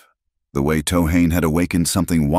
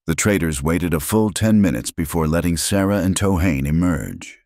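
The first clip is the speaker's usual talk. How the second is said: ordinary delivery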